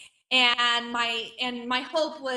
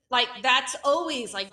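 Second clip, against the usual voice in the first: normal talking voice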